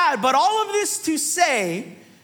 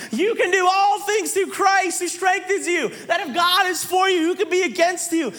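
high, rolling string of promises; imitated preacher's exhortation; 5 semitones higher than usual. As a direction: pile up promises like an eager preacher. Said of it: "because the preacher would say".

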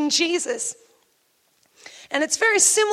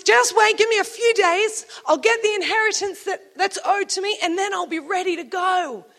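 urgent stalling; 4 semitones higher than usual. Acted. Follower asking Jesus to wait for the inheritance, urgent stalling.

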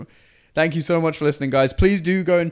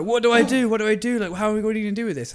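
high, hurried imitation of an excited kid; excited child; 7 semitones higher, faster than usual. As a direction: play a flustered, excited schoolboy asking for help. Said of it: "He was very excited during P E".

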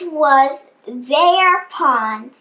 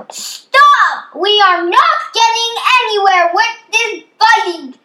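high, loud commanding cry; chief frog's shout; 6 semitones higher, 4 dB louder than usual. A narrator's reading. Chief frog stops the fighting animals, loud shout. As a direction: shout over the squabble to halt it. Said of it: "shouted out".

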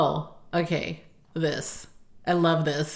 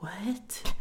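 hushed amazement; 10 dB quieter than usual. Retold or imitated. Acting her amazed younger self.

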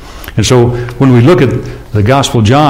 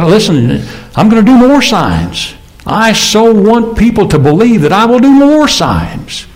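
much higher, slow and yearning; pleading Jesus; 11 semitones higher than usual. Acted. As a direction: let Jesus' longing soar up high. Preacher voicing Jesus pleading.

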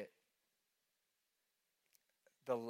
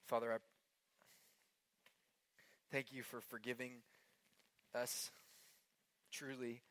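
quiet prayer; 5 dB quieter than usual. Acted. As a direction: pray gently over the room. Leads closing prayer.

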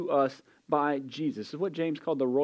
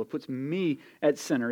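normal talking voice

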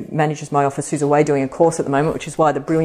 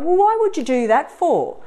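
fiery retort; 10 semitones higher than usual. Retold or imitated. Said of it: "I'm like".

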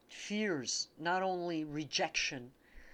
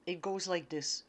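ordinary talk

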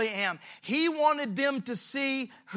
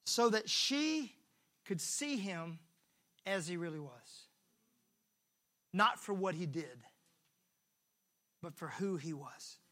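tender hush; 5 semitones lower, 9 dB quieter than usual. Real, not acted. Preacher softly telling the congregation why, reverently. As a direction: draw them in gently.

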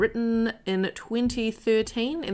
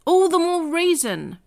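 much higher and louder, bursting with eagerness; excited; 9 semitones higher, 6 dB louder than usual. Acted. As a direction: cheer him on, slowed and emphatic. Nick eagerly urging the trip.

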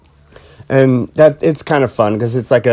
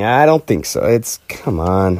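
usual speaking voice

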